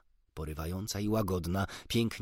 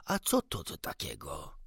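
bewildered doctor, pitch falling away; astonished question; 6 semitones lower than usual. A narrator's reading. Doctor hearing a strange sweet sound, astonished. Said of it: "zawołał doktor".

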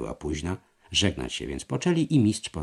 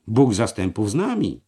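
loud, fervent rallying cry; calling out; 3 semitones higher, 7 dB louder than usual. A narrator's reading. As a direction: proclaim it loudly to stir the others. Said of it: "wołał Liechtenstein". (głośne, żarliwe wołanie)

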